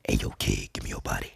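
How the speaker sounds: very low, menacing growl; deep villain voice. Retold or imitated. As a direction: drop way down for a creepy villain. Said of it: "Sledge Villain was like".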